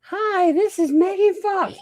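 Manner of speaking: put-on girl voice, very high falsetto; squeaky, drawn-out mock female caller; Megan Fox. Dad pretending a star phones in, high girl voice. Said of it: "girl voice".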